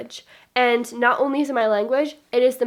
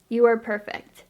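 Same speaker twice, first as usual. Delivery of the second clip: usual speaking voice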